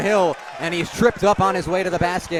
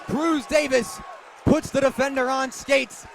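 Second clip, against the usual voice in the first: high, loud rising call that keeps ringing after the shot; excited call; 6 semitones higher, 3 dB louder than usual. Real, not acted. Play-by-play man calls the tying three, thrilled. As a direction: erupt as the three drops, then keep the energy high.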